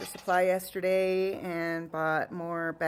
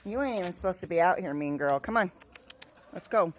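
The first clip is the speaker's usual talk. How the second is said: ordinary talk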